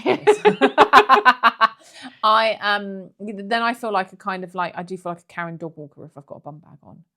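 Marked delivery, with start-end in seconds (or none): 0.5-1.7 big burst of laughter; loud laugh. Real, not acted.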